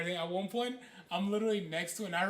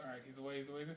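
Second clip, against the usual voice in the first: hushed sneaking; 6 semitones lower, 14 dB quieter, much faster than usual. Retold or imitated. Sneaking past a boss in game.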